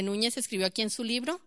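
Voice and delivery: ordinary delivery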